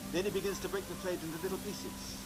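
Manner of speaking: measured showman narration over music; magician's stage patter. Acted. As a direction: present each trick with a flourish. Magic show presenter patter.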